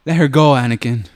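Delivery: acted Obi-Wan line. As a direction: project it like a stern movie Jedi. Acting Obi-Wan's line on cue.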